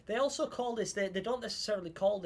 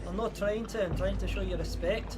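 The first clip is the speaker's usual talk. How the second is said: slightly lifted Scottish lilt; reply politely in a put-on Scottish accent; 3 semitones higher than usual. Streamer thanks a Scottish viewer, in Scottish accent. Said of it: "that accent's actually good".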